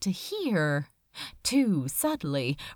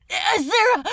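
a high, loud cry broken off; anguished cry; 10 semitones higher, 8 dB louder than usual. A narrator's reading. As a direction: shout his name and choke off. Crowley cries out, cut off.

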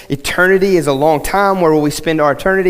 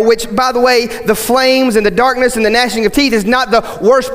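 higher, urgent preaching to the church; preaching at full force; 6 semitones higher than usual. Acted. Pastor urging his church, preaching at full force. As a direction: drive the point home from the pulpit.

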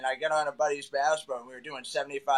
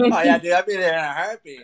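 loud laughing; 9 dB louder than usual. Real, not acted. Joking reply to teasing.

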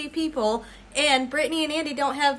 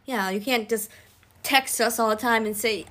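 ordinary delivery

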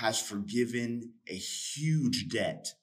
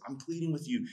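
pleading; 6 semitones higher than usual. Retold or imitated. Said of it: "He says".

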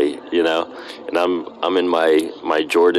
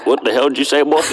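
higher-pitched mock country-boy challenge; imitated country boy accent; 8 semitones higher, 3 dB louder, faster than usual. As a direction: put on a Southern country boy calling someone out. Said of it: "country boys are like".

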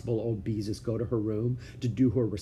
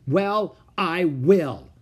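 loud, high, stubborn; defiant; 6 semitones higher, 8 dB louder, much slower than usual. Acted. Ramona defies her father, loud. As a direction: throw it back at him. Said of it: "Ramona was defiant".

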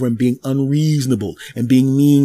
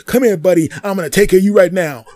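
taunting tough guy; 8 semitones higher, 5 dB louder than usual. Retold or imitated. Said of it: "bottle guy taunting".

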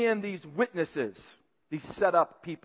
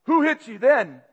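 louder, sneering jeer; mocking jeer; 4 semitones higher, 5 dB louder than usual. Guards mocking blindfolded Jesus, loud jeer. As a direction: laugh at him with the question. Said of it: "They mocked him".

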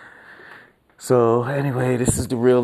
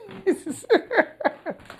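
squealing laugh, pitched way up; high laugh. Real, not acted. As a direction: burst into a shrill-free high giggle. Laughing after swearing.

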